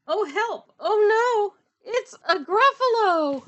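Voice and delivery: high, loud storybook cry of dismay; mock alarm. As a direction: wail it in exaggerated fright for children. Mouse suddenly meets the Gruffalo.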